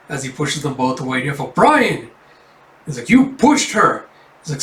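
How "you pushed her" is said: put-on high, loud childish accusation; a boy shouting blame; high kid voice, indignant; accusing kid. Kids quarrel.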